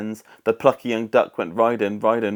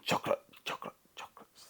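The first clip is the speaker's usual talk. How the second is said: whispered, fading echo; cave echo; 12 dB quieter, much faster than usual. A narrator's reading. Echo answering the duck from the cave, whispered. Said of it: "the voice from the cave replied".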